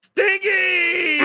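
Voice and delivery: high, loud jeer at the Cowboys; mocking shriek. Real, not acted. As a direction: squeal the insult.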